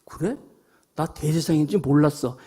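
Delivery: softly playing dumb; a sly, hushed "oh, I didn't know"; feigned innocence. Preacher voicing Paul's cheeky excuse to the crowd, softly feigning innocence. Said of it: "그랬더니 바울이".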